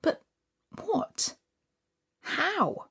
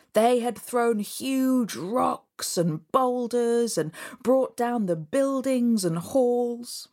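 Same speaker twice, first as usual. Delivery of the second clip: ordinary delivery, nothing marked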